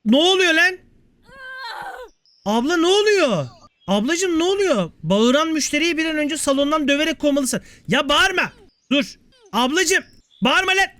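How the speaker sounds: high-pitched, alarmed exasperation; startled protest. Real, not acted. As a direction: protest at a screaming customer, pitch jumping up. Startled by screaming game customer. (tiz sesle şaşkın isyan)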